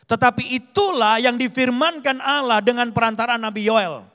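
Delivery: loud, emphatic Bible reading; scripture proclamation. A narrator's reading. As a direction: proclaim the verse to the congregation. Reads scripture aloud with force. (membacakan firman dengan lantang)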